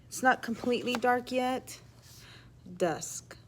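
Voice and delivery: plain reading voice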